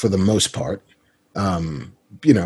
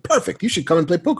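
lightly voiced friend, pitched higher; a higher-pitched take on an eager friend; Kevin impression; 9 semitones higher, faster than usual. Friend inviting him to poker, lightly imitated, higher. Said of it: "The way you do his".

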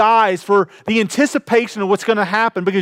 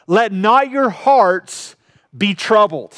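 slow scripture quote; slower than usual. Acted. Preacher quotes Jesus slowly.

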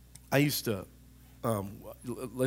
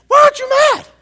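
very high, very loud mock scream; acted outrage; 17 dB louder than usual. Angry person confronting someone who isn't mad, screaming. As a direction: shriek it as an outraged accuser. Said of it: "you get mad if somebody's not mad".

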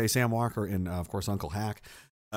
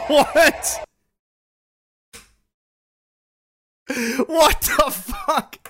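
high, loud, slow "what the fuck"; real shock, laughing it out; stunned disbelief; 12 semitones higher, 6 dB louder, much slower than usual. Real, not acted. Stunned by the clip's shout.

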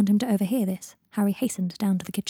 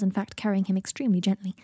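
plain reading voice

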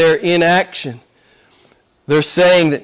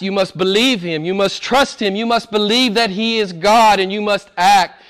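ordinary delivery

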